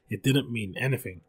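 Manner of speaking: plain reading voice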